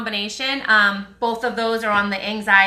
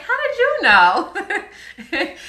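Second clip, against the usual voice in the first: high, slow, laughing mock-innocent reply; mock innocent surprise; 9 semitones higher, 3 dB louder, much slower than usual. Answering people who spot her accent, mock surprised. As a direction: play dumb with a laugh. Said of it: "I'm like".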